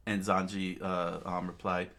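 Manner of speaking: ordinary delivery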